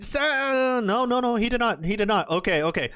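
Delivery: ordinary talk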